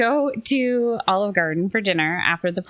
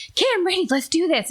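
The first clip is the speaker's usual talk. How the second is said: put-on high, eager little-girl voice; pumped-up kid ready to take the stage; 12 semitones higher, faster than usual. Child eager to perform for table.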